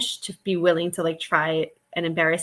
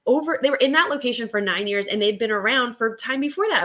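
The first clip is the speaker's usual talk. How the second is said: usual speaking voice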